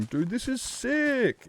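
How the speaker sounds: drawn-out high dude, this is sick; amazed exclamation. Retold or imitated. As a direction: marvel at a friend's build. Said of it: "and I'm going".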